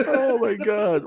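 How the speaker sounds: high, slow, laughing; drawn-out laughing gasp; laughing at his friend's joke, high and slow. Real, not acted.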